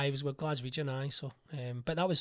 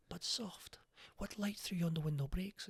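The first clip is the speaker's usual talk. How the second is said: much softer, slow, tender delivery of "But soft, what light through yonder window breaks?"; soft romantic Romeo; 3 semitones higher, 8 dB quieter, slower than usual. Shown as a demonstration. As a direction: breathe it out like a dreamy lover. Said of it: "I don't want him soft".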